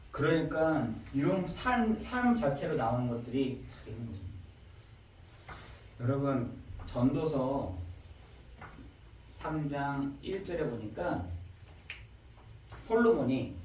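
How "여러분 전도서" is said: ordinary talk, nothing marked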